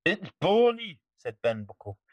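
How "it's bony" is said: disgusted pirate. Acted. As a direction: whine it with disgust. Pirate complains.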